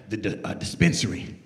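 low, hesitant mumble; sheepish son admitting the job. Retold or imitated. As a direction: say it like you're embarrassed.

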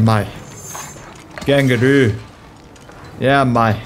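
lightly put-on Australian accent; Aussie accent. Player trying out Mad Max's Australian talk, half-hearted Aussie accent. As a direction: have a rough go at Aussie. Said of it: "Come on, Aussie accent".

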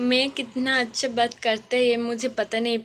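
ordinary delivery

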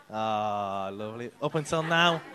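normal talking voice

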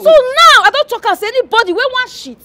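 exasperated announcement. Acted. Landlady announces new toilet rule.

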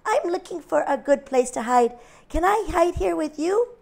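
high, small lamb voice; lightly voiced little lamb. A narrator's reading. Little lamb politely asking the cow for help. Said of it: "said Little Lamb".

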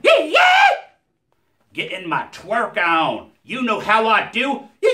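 high, shouted hype character voice; Earl voice. Acted. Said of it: "Earl is not easy on the voice box".

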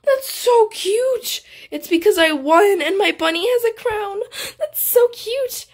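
high, drawn-out squeal of delight; cute-overload gushing. Real, not acted. Player delighted by her bunny's crown, high gushing.

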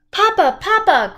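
high, loud shout; a girl calling out for her lost father. A narrator's reading. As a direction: shout for papa in the crowd. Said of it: "Kristen shouted".

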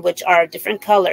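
usual speaking voice

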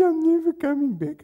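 high squeaky mimicry of a grumbling man; mock his whiny complaint in a high voice; mock sulky member. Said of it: "He said".

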